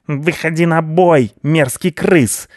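high, slow yelled challenge to a fight; a cat yowling a dare, drawn out. Acted. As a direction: bellow it high and slow at the rat. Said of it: "завопил Рыжопиус".

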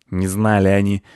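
dropped, unimpressed mocking repeat; scornful echo. Squirrel echoes the boys' excuse, scornful. Squirrel throws boy's words back.

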